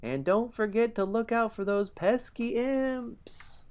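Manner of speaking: high, slow gnome call from afar; gnome yelling. Acted. Gnome calling goodbye down the hallway, high mock yell. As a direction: call out a parting warning as a tiny gnome. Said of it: "a gnome yelling".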